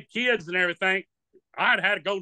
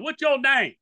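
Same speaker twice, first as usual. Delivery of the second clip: comedian calling out; 6 semitones higher than usual. Retold or imitated. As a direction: push for the name. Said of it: "He goes".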